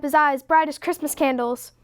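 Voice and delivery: plain reading voice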